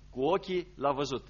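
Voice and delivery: ordinary talk, nothing marked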